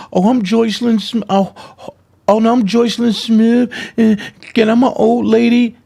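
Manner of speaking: high mocking imitation of her old-lady act; hammed-up old lady impression. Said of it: "Nobody misinterprets your deep-ass voice".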